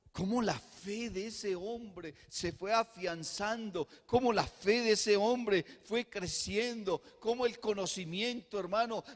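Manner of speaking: swelling pulpit fervor; building fervent preaching. Acted. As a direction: lift the church step by step. Sermon climbing to climax.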